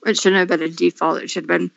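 talking through a cookie; eating while talking; mouth full. Real, not acted. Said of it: "I'm eating a cookie as we do this".

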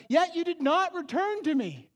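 loud, high anguished cry of a spurned God; heartbroken plea. Acted. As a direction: let the heartbreak burst out on not. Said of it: "You can hear his heart is breaking".

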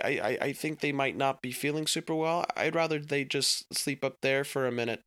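put-on a boy covering up, stammering; lightly voiced anxious child; nervous boy.

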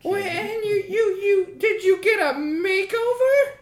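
high, near-tearful wail; a hurt boss almost crying; whimpering boss. Acted. Boss to his wife, high teary whimper.

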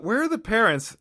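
retold exasperated question; indignant thought. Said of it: "And I thought".

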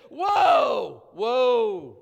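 loud drawn-out whoa of awe; booming amazed whoa; awestruck whoa. Retold or imitated. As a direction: shout it like you're overwhelmed by something awesome. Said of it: "that's the kind of whoa".

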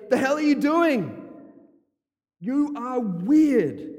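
high, loud, incredulous mock outsider; mock baffled outsider. Preacher voicing baffled neighbours, high and loud mock disbelief. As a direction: squawk it like a scandalised neighbour. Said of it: "people are gonna say".

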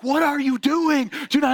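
high-pitched, stretched-out disbelief; exasperated wife. Retold or imitated. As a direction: imitate an exasperated wife's protest. Wife scolding him in retold story.

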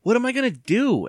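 high, loud despairing wail. A narrator's reading. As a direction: wail it helplessly. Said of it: "Evan wailed".

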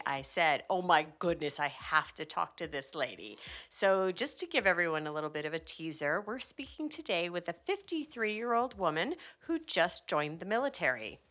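normal talking voice

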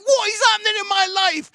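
very high, loud, disbelieving yells; mock shocked shout. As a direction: shriek the what like a stunned dad. Exasperated dad at home.

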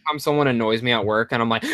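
ordinary talk

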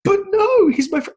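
very high, loud sobbing protest; crying kid. Retold or imitated. Podcaster imitating the sobbing child actor. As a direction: wail that he's your friend. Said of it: "And he's just like".

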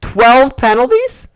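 high, loud, slow disbelief; incredulous outburst. Real, not acted. Fan hearing the penalty count, incredulous.

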